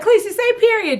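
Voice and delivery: put-on high-pitched mom prompting her kid; high singsong parent voice; coaxing a toddler.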